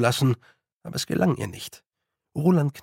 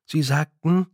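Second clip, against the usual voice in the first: usual speaking voice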